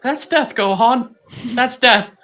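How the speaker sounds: mock dread. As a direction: deliver a hammed-up warning of doom. Acted reply to Gohan in scene.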